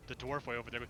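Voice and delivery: normal talking voice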